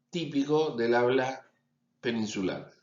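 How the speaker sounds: ordinary talk, nothing marked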